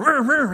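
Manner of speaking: playful vroom-vroom growl; imitated engine revving. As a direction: rev like an impatient driver cutting in. Impatient drivers rushing in traffic.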